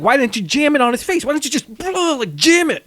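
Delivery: high-pitched, exasperated imitation; mock arguing. Sidney arguing with Billy over the layup, high mock exasperation. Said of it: "they start arguing about that".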